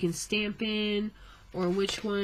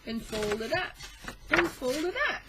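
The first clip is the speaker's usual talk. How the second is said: laughing; 9 semitones higher than usual. Real, not acted. Laughing at the foldout.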